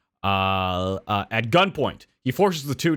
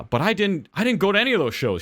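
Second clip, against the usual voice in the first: put-on indignant old lady; 8 semitones higher than usual. Old woman protests again.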